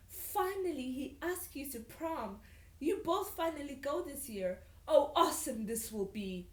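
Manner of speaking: light squeal of delight; mild delighted squeal. A narrator's reading. Sasha thrilled about prom, mildly squealing with delight. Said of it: "Sasha squealed with delight".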